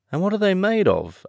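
very high, eager question; high, wide-eyed inquisitive Alice; great curiosity. Acted. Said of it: "in a tone of great curiosity".

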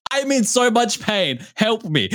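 high, slow whimpering plea; pained hero. Retold or imitated. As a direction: whine it like a wounded hero. Mocking a hurt action hero.

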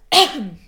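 sudden cough from swallowing wrong; choking cough. Real, not acted. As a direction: cough hard, something went down wrong.

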